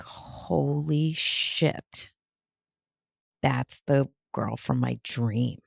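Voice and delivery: awed hush. Retold or imitated. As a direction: let the shock drain the voice. Client awed at discovery.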